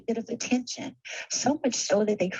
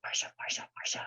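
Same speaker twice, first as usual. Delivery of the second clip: whispered, much softer jealous-sister moan; Jane Brady voice; 9 dB quieter, much faster than usual. Retold or imitated. Quoting a sitcom sister's jealous complaint in a whisper. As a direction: breathe the name out like a resentful sister. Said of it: "in my best Jane Brady voice".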